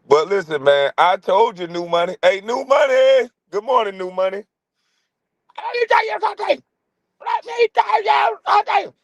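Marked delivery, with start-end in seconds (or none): 5.6-8.9 very high, frantic shrieking character voice; Fire Marshal Bill. Retold or imitated. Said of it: "Fire Marshal Bill".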